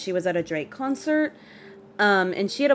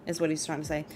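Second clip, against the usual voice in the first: normal talking voice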